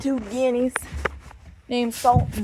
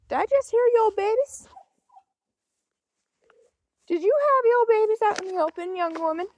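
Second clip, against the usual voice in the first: high sing-song voice scolding a cat; high playful mock-scolding to the cat; pet talk; 10 semitones higher than usual. Real, not acted. Talking to mother cat.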